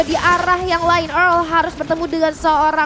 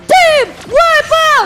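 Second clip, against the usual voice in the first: drawn-out, much higher hype yell; fighting game announcer; 12 semitones higher, 8 dB louder, much slower than usual. Real, not acted. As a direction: stretch it out like an arena announcer. Caster hyping a team fight.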